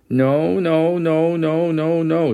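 put-on high frightened child's screaming; panicked little girl screaming no over and over. A narrator's reading. Frightened daughter refusing the smoke in the car, screaming high. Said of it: "Jessie started screaming".

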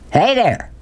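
high-pitched holler, no real volume; hollered greeting. A narrator's reading. Big man greets boy from his Jeep, hollering. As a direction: call out high in a hearty drawl. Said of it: "he hollered".

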